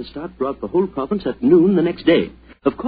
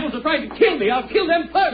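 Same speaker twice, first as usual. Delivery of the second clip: high, frantic raving; wild-eyed panicked threat; frantic shouting; 12 semitones higher than usual. Acted. Colonel raving, about to throw spear.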